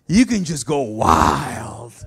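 loud, low, drawn-out cutting loose; mock wildness. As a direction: stretch it out low and loud, playfully unleashed. Acts out going wild outside sanctuary.

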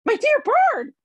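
a startled hobbit's squeak. A narrator's reading. Bilbo squeaks at suspicious Bard, high and loud. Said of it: "squeaked Bilbo".

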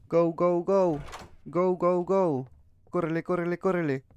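high, repeated urging; anxious high-pitched chant; urgent hurrying. Real, not acted. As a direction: egg yourself on in a hurry. Hurrying before the candle goes out.

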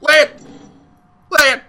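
loud excited yells of land; shouting. Real, not acted. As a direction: yell it out, hyped. Yelling at the draw.

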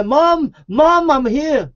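high, drawn-out call to mom; high-pitched cry to be found. Acted. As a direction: call out like a lost child to their mother. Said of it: "then we can say".

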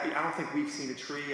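usual speaking voice

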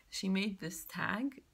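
ordinary delivery

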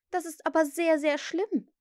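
put-on high girl's voice, dismayed; concerned girl. A narrator's reading. Wendy hears the boys know no stories, dismayed. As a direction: lift the voice in earnest concern.

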